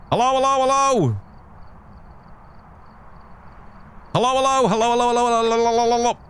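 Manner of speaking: high sing-song hellos; calling hello. Real, not acted. Testing voice activation, high repeated hellos. Mic test again.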